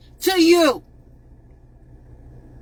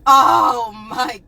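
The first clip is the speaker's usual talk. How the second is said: mock grieving fans; 5 semitones lower, slower than usual. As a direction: wail it like a fake mourner. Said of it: "everybody is".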